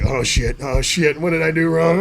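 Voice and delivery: half-joking panic, climbing in pitch; mock panic. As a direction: play the kid who thinks he's in trouble, building up. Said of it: "And I was like".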